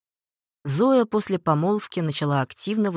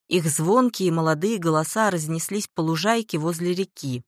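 ordinary delivery

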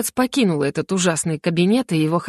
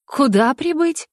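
high, slow, disbelieving question; taken aback; 6 semitones higher, slower than usual. A narrator's reading. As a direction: draw it out in bewilderment. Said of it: "Я обескураженно обернулась". (обескураженно, высоким голосом)

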